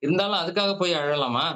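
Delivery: ordinary talk, nothing marked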